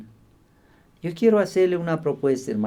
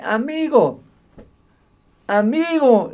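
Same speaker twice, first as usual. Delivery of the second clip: ordinary delivery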